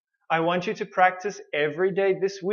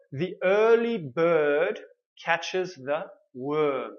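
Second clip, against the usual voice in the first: slow, big, overarticulated; muscle-training demo voice; exaggerated clear speech; much slower than usual. Shown as a demonstration. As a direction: stretch every sound with big mouth movements. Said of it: "I talk like this if I want to make my sounds clear".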